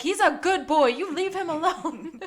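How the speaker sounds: mock-indignant abuelita; protective abuelita. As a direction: imitate a grandma defending her boy. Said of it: "His abuelita is following him".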